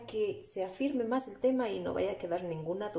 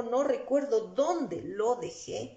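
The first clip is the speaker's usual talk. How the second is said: normal talking voice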